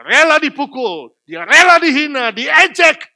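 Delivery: loud, high, driving sermon climax; preaching at full force. Acted. Preacher to congregation, loud driving sermon. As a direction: hammer the gospel home from the pulpit.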